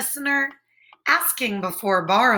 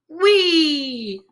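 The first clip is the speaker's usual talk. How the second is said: playful wee; 4 semitones higher than usual. Acted. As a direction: squeal with joy down a slide. Otters playing.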